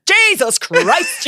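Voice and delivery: slow, high, drawn-out sigh of complaint; exasperated. Retold or imitated. Mum starts giving off again, exasperated. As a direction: drag it out in exasperation. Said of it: "She's like".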